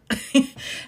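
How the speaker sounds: a quick unvoiced burst of laughter; laughing out at the story; breathy laugh. Real, not acted. Laughs at her own story.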